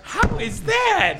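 slow, high, stretched-out question; high drawn-out disbelief. Real, not acted. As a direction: drag it out in disbelief. Repeats it drawn out, higher.

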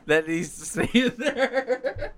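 slow, high, laughing. Real, not acted. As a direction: say it while cracking up. Laughing reply.

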